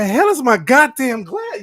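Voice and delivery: high, loud, slow exasperated shout; frantic yell. Retold or imitated. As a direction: bellow it like you lost your glasses for good. Mock frantic search.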